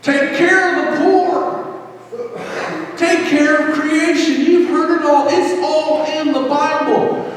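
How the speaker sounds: high, impassioned Southern revival preaching; full-throated Southern accent preaching; Southern preacher accent. Acted. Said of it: "gotta have that Southern accent".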